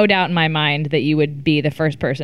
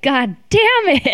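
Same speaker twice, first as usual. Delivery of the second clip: a mock annoyed curse, a touch higher; mock frustration; 6 semitones higher than usual. Production staff cursing her quitting the puzzle, mock annoyed. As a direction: toss it off as a mock exasperated producer. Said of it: "I'm sure production was like".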